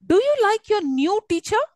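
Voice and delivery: high-pitched question climbing to "teacher"; high rising "Do you like your new teacher". Shown as a demonstration. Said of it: "Can you see my tone, which is rising up".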